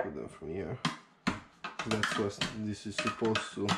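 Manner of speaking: ordinary talk, nothing marked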